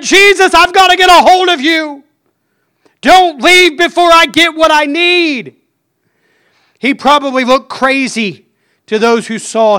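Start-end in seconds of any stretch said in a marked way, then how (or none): 0.0-5.5 higher, loud desperate pleading; louder, stronger plea. Retold or imitated. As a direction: beg as if he might leave any second. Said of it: "he said it even louder and, and even stronger".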